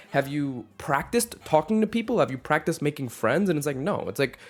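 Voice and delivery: ordinary talk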